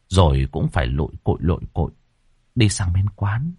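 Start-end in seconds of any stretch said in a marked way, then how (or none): none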